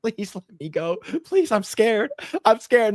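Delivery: high, pleading mock fear; mock scared plea. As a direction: imitate a scared handcuffed man. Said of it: "He's like".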